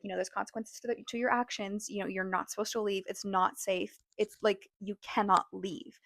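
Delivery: ordinary delivery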